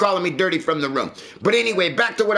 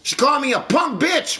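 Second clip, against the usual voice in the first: indignant shout; 8 semitones higher than usual. Real, not acted. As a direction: shout it out in disbelief. Outraged at girlfriend's insult.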